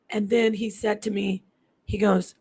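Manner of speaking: usual speaking voice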